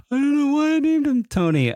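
squeaky high laugh through the words. Real, not acted. Host laughing about naming her childhood cat Tony. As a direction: let the laugh push the voice way up.

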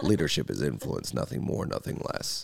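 low breathy guru maxim; John Maxwell voice. Acted. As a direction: fade to a hushed low rasp. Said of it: "my John Maxwell stage".

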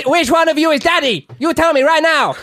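high, pleading son's voice; whiny kid voice. Acted. Son with a gun demanding to know his real dad, high and whiny. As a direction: demand to know which is daddy, like a little kid. Son David confronting two Hunters.

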